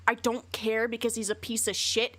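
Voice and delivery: usual speaking voice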